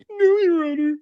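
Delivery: very high fake-weepy whine; mock crocodile tears. Streamer mimicking woman pleading to the judge, fake tearful. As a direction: badly fake crying to the judge. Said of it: "crocodile tears moment here".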